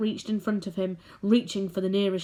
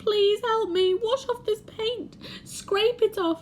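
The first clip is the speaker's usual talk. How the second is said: pleading centipede; 14 semitones higher, slower than usual. Acted. Centipede begging James to wash off the paint, high pleading. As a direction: beg desperately.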